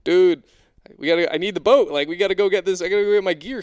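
loud, high urgent plea; urgent shout. Retold or imitated. Diver urging Trevor to bring the boat, loud. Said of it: "I'm like".

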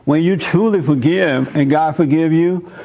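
normal talking voice

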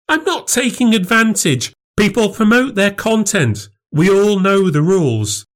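very high, heated denial; defensive outrage. Acted. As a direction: fight off the accusation. Quarrel peaks, denial.

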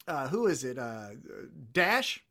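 ordinary talk

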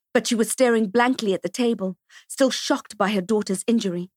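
ordinary delivery, nothing marked

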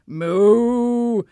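short cow moo. Acted. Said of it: "adding a few moos".

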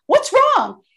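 a mom surprised by her daughter's scream, asking what happened; concerned what's wrong. Retold or imitated.

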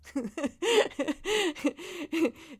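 real high-pitched burst of laughter; laughing. Real, not acted. Podcaster amused by her own New York joke, laughing. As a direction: crack up at your own joke.

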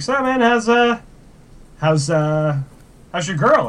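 upbeat imitation of a carefree friend; goofy Luis voice. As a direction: mimic the grinning sidekick chatting away.

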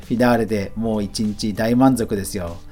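ordinary talk

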